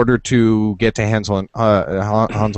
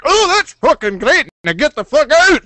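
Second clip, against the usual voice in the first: hammed-up dismissive fairy, much higher; mock fairy outburst; 12 semitones higher than usual. Fairies chase off the man with the bagpipe, high mock shout. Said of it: "and they're like".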